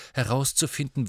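plain reading voice

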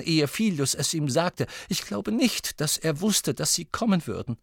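high, distraught voice; upset professor, anxiously explaining; Professor McGonagall. Acted.

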